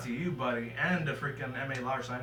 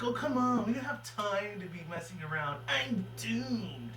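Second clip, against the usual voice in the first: Rainbow Dash; 8 semitones higher, slower than usual. Retold or imitated. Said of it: "Rainbow Dash was like".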